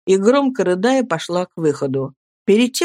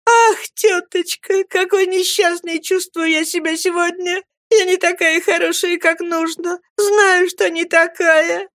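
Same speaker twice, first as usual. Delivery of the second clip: put-on sobbing young girl; 11 semitones higher than usual. A narrator's reading. Girl sobbing to her aunt.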